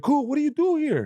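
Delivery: high, offhand and breezy; breezy dismissive question. Retold or imitated. Skit maker brushes off the stranger, high and offhand. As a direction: toss it off like he's nobody.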